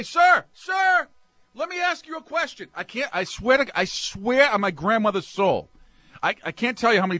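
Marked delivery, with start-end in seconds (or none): 0.0-2.7 mock saleswoman call. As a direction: call out high like a clerk chasing a customer. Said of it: "they start yelling at you".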